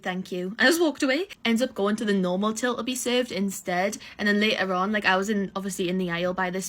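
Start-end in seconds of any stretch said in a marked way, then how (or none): 0.0-0.6 clipped, softer thank you as a brush-off; curt dismissal. Retold or imitated. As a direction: close the door on her politely. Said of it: "I went".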